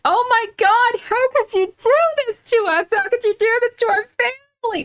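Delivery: high, loud, shrill mock scolding; screaming mom impression. Streamer mimicking a hysterical mom yelling at her child. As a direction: wail at the kid like a frantic mom. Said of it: "a mom screaming at a child going like".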